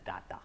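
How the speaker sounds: data as "dah-ta", said low and soft; wrong pronunciation. Shown as a demonstration. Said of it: "it cannot be pronounced as".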